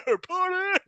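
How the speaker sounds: high, loud partygoer's whoop; hyped-up mock party cry; rowdy party shout. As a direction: burst in yelling like a reveller. Partygoer bursting in, acted out.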